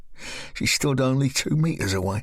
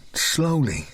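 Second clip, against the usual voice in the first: ordinary delivery, nothing marked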